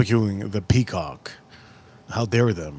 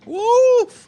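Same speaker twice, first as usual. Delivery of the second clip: much higher, louder woof; loud spicy woof; 5 dB louder than usual. Real, not acted. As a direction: blow out the heat. Spice reaction.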